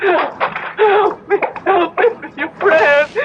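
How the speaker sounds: crying as she begs for help; tearful pleading. Acted. A desperate mother begs the rabbi for her dying daughter, crying. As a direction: beg through tears.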